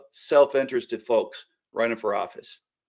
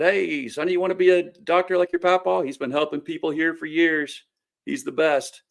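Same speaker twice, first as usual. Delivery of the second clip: higher, lightly voiced friendly patient; patient's voice; 6 semitones higher than usual. Patient praising grandpa to the boy, lightly voiced and higher. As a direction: chat up the kid a bit higher, folksy. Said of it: "patient looks at him and said".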